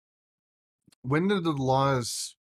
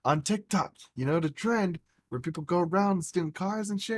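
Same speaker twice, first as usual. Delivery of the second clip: higher, eager prompting; retold insistence; 6 semitones higher than usual. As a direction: jog mom's memory, animated. Retold insistent explanation to mom.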